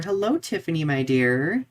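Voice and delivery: usual speaking voice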